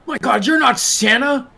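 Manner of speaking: high, incredulous exclamation; shocked realization. Acted. Billy realizes Santa is an impostor, shocked.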